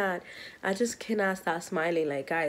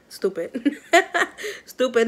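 laughing through the word, louder; 5 semitones higher, 4 dB louder, slower than usual. Real, not acted. Laughing at herself to viewers. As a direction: giggle out stupid at yourself. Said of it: "I can't stop laughing".

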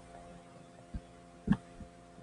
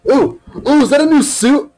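excited; 13 semitones higher than usual. Real, not acted. Spotting a new suit in game.

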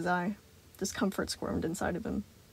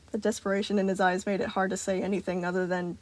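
ordinary delivery

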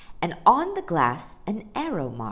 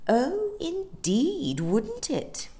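knowing superiority; 10 semitones higher, slower than usual. A narrator's reading. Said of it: "curious I know better expression".

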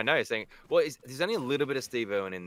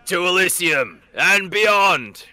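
slow, much louder and higher heroic call; mock battle cry; 8 semitones higher, 12 dB louder, much slower than usual. Dropping into the map, hammed-up heroic shout. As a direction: proclaim it like a hammy space hero.

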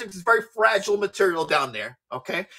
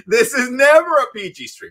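laughing repeat; 3 semitones higher, 5 dB louder than usual. Real, not acted.

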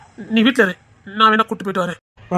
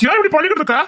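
shouting; 9 semitones higher, faster than usual. Acted. Husband shouting at his avoiding wife, angry. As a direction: shout it at her in frustration. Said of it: "ஏன் கத்துறீங்க?".

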